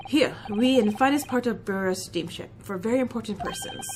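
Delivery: put-on a proud Russian crewman, heavy accent; Russian seaman introducing himself, low and gruff; Stroganoff, Russian accent.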